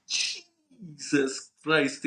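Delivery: very drawn-out, stunned exclamation. Retold or imitated. Host retelling his shock at the fortune, stunned. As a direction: stretch the first word right out in disbelief.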